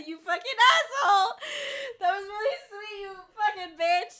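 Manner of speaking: loud, high, laughing through tears; tearful mock-angry outburst; crying. Real, not acted. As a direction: curse fondly while crying and laughing. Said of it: "Sorry I'm crying".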